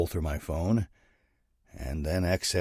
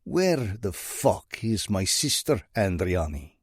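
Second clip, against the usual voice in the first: put-on Russian accent drawl; slower than usual. Russian mob boss demanding his sister back, slow Russian drawl. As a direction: let the Russian accent drag each word out. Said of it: "a voice laced with a Russian accent drawls".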